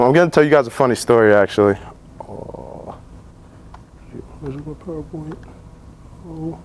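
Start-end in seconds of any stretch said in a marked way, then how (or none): 2.2-6.6 very quiet, to yourself; muttered aside. Real, not acted. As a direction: murmur it off to the side while hunting for slides. Looking for lost slides mid-talk.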